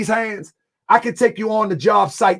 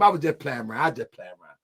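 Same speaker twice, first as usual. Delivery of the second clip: sheepish quiet; 11 semitones lower, 6 dB quieter, faster than usual. Real, not acted. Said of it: "repeats apology quieter each time".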